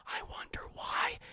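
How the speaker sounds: sly whispered aside; hushed knowing jab. Real, not acted. Teasing chat in a conspiratorial whisper. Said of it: "unvoiced whisper".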